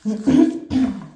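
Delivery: sharp loud throat clear. Real, not acted.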